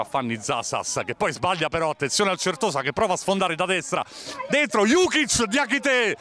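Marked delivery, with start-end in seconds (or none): 4.5-6.2 excited. Real, not acted. Live save call.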